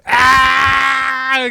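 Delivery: sudden high shout of delight; excited shout. Real, not acted. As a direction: yell out in excitement. Reacts to Jaylen Brown pick.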